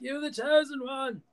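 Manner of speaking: high, hammed-up movie wail; mock speech. Mocking a hero's speech to the fallen one, high hammed-up cry. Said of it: "time to say a speech of".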